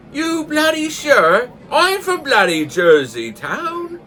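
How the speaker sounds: high, slow, hammed-up disbelief in a Newfoundland accent; astonished Newfoundlander. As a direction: play up the shock, Newfoundland style. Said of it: "a newfie joke".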